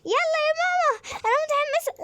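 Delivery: put-on very high squeaky child voice; high-pitched kid voice begging mom; excited little girl. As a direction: play the eager little daughter. Voicing kid character Malak in game.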